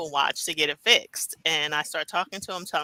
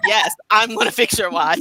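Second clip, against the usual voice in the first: emphatic reply; 5 semitones higher, 7 dB louder than usual. Retold or imitated.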